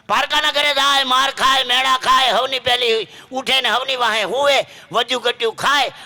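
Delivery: high, loud rousing list; ringing public-speaker crescendo, pitched high; impassioned oratory. Real, not acted. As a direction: proclaim each hardship at full force. Impassioned tribute listing a daughter's hardships.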